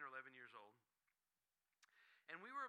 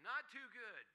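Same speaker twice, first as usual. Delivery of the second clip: grumpy reply; 8 semitones higher, 8 dB louder, slower than usual. Retold or imitated. Grumpy waitress answers.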